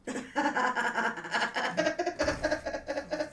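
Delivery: loud high burst of laughter; laughing at the producer joke; long laugh. Real, not acted. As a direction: crack up at your own joke.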